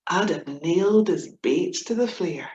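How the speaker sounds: lively comic verse reading; poem recitation. Acted. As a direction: perform the poem with gusto. Reciting her comic Scots poem.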